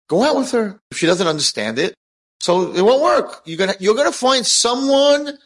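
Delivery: high, loud, fervent push to go for it; excited urging. Retold or imitated. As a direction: cheer him on, voice shooting up. Urging friend to date the girl.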